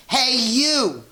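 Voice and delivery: high, loud challenge from a tiny bug; grouchy ladybug. A narrator's reading. Ladybug squaring up to the rhinoceros, high and loud. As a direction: squeak it out like a picking-a-fight bug. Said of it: "said the grouchy ladybug".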